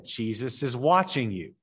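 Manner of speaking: normal talking voice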